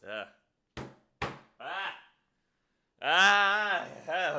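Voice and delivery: short growls building to a loud, high yell; growl attempts. Shown as a demonstration. Singer warming up horror-game growls, throaty attempts. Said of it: "I need my fnaf growls to come back".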